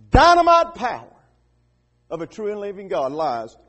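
loud, high, mounting proclamation; full-force preaching. Real, not acted. Preacher rallying believers to declare the gospel, full-force preaching. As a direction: build phrase by phrase to a shouted peak.

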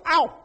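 a sudden high yelp, as if burnt; mock yelp of pain. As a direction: yelp like you just burned your hand. Imitates burning your hand.

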